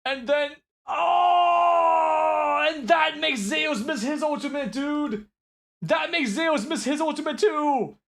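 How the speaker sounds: long drawn-out high shouted "oh"s; anguished disbelief. Real, not acted. Analyst reacting to Zeus missing his ultimate, anguished disbelief. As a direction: wail it high, stretching the words out. Reacting to a costly misplay in replay.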